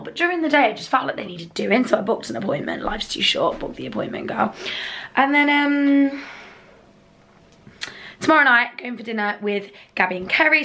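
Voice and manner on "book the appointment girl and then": ordinary talk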